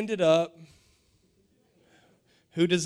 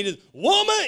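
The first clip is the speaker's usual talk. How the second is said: mock curt; 9 semitones higher, 4 dB louder than usual. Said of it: "And be like".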